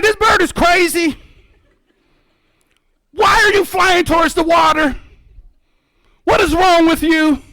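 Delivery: high, loud panicked outcry; hammed-up shouted freak-out. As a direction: yell it in alarmed disbelief. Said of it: "I start freaking out".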